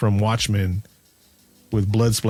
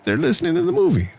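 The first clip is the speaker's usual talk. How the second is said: high excited; faster than usual. Retold or imitated. Reacting to movie, excited.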